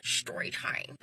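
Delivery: breathy hushed story time, almost voiceless; soft unvoiced story time. Shown as a demonstration. Trying out the intro, hushed and unvoiced. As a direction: say it under your breath, no voice at all. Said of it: "it feels hella weird saying that".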